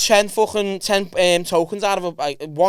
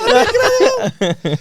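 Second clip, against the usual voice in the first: high, slow, laughing squeal; real laughter at the bit; 5 dB louder, slower than usual. Real, not acted. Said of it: "laughing, high squeal".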